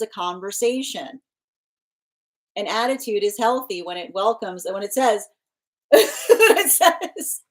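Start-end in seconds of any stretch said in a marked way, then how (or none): 5.6-7.4 laughing. Real, not acted. Laughs mid explanation.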